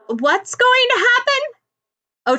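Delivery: calling up. Acted. Ochako calling up to Bakugo's feet, anxious call. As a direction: call up anxiously to someone high above. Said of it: "Ochako calls up".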